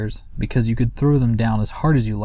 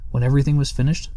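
plain reading voice

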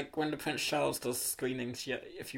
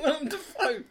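laughing; 12 semitones higher, 5 dB louder than usual. Real, not acted. Joking about the name Dafoe.

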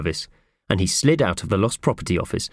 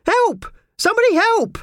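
extremely high, loud cry for help; shrieking for help; 4 dB louder than usual. A narrator's reading. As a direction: shriek to summon anyone nearby. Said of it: "shrieked the woman".

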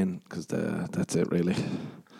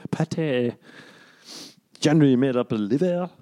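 put-on, unconvincing French accent; mock fake-French accent; 4 semitones higher than usual. Mimicking an English dude being a French guy, mock French accent. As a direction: parody a bad French-accented narrator. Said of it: "he doesn't even sound French".

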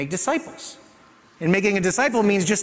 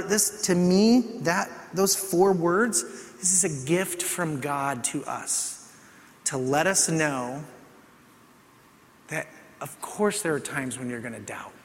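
usual speaking voice

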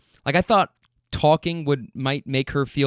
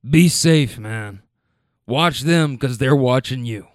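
put-on wise old guy; 3 dB louder, slower than usual. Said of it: "drops the one-liner of the week".

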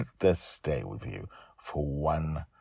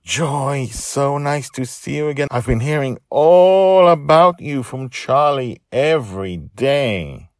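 high, loud, effusive woman character; high-pitched, over-friendly welcome; gushing greeting; 8 semitones higher, 9 dB louder than usual. Acted. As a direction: gush hello with long stressed words. Mother greets Joy in the story.

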